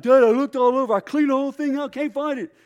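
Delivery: put-on high, loud whining girl; whiny daughter. Daughter protesting to her dad, loud high whine. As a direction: complain you searched everything.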